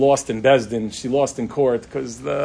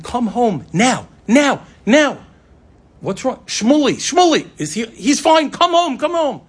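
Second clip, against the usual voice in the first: frantic call; 10 semitones higher, 6 dB louder than usual. Retold or imitated. Mother frantically calls husband.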